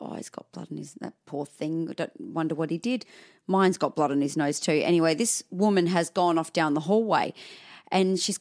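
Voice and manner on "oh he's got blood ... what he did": very soft aside to oneself; private thought. Retold or imitated. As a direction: think aloud under your breath. Inner thought about other child.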